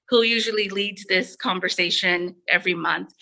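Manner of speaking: ordinary talk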